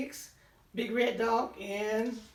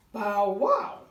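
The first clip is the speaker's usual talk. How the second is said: louder playful bow wow; barking dog; 7 dB louder than usual. Acted. Teacher barking for the big red dog, louder.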